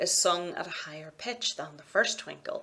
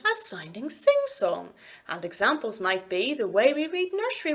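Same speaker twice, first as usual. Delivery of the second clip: sing-song lilt with big rises and falls; lilting, exaggerated tune like an in-store announcer; exaggerated sing-song intonation; 2 semitones higher than usual. Shown as a demonstration. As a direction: swoop the pitch up and down as if reading a nursery rhyme. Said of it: "or the way I just spoke that paragraph".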